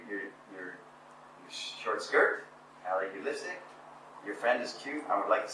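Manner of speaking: a shy man mumbling a compliment, losing his nerve; nervous, timid compliments. Retold or imitated. Nervous pickup line, faltering.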